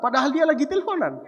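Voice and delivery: fast, laughing remark. Real, not acted. Preacher laughing at his father's phone trick. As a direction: laugh through the punchline.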